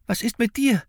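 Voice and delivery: put-on high-pitched anxious boy's voice; worried boy. A narrator's reading. Finn to the old man who sends him off, high and worried. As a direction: ask it high and urgently.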